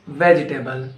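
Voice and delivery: "vegetable" as VEJ-tuh-bul, falling; corrected VEGETABLE. Shown as a demonstration. Teacher correcting learners, "vegetable" said as VEJ-tuh-bul. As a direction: say "vegetable" with a reduced ending. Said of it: "No, it's not vegetable".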